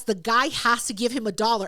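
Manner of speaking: ordinary talk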